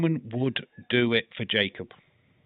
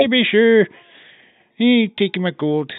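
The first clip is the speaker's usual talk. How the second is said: high-pitched mock Irish brogue; hammed-up Irish leprechaun, pitched up; leprechaun Irish accent; 6 semitones higher than usual. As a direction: put on a comic Irish leprechaun. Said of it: "a proper leprechaun".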